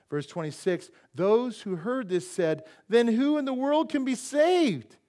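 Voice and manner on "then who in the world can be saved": louder, higher incredulous outcry; astonished question. Acted. Disciples react to Jesus, loud astonishment. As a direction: exclaim it in loud disbelief.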